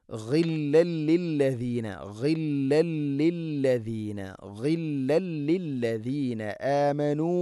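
ഗില്ലൻ slow with a throaty ഗ; careful ghain. Shown as a demonstration. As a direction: recite ഗില്ലൻ ലില്ലദീന slowly and carefully. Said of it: "അതുകൊണ്ട് ശ്രദ്ധിക്കണം". (ഗില്ലൻ ശ്രദ്ധയോടെ ഓതുക)

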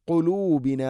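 ഖുലൂബിന with a deep throat ഖ, not ക; deep qaf. Shown as a demonstration. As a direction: sound ഖുലൂബിന from the back of the throat. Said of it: "കുലൂബിന ആവരുത്". (ഖുലൂബിന ഖാഫ് ഉച്ചാരണം)

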